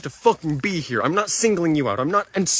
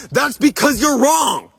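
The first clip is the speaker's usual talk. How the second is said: slow, high, loud yell; real outraged shout, drawn out; furious retort; 7 semitones higher, 5 dB louder, slower than usual. Real, not acted. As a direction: hammer out each word in anger.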